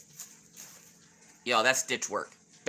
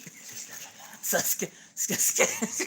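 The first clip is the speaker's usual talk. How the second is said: whispered, stuttering restarts; too flustered to get the word out; soft whispered stammer before a laugh; 7 dB quieter, much slower than usual. Real, not acted. Flustered by viewer's name.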